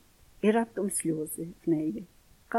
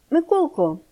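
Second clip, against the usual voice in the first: higher, louder reproachful call; a mother's gentle reproach; 8 semitones higher, 6 dB louder than usual. A narrator's reading. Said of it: "каже вона докірливо". (докірливо)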